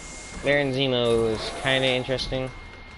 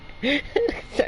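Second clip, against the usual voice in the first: a burst of high laughter; high laugh at own joke; 11 semitones higher, 3 dB quieter than usual. Real, not acted.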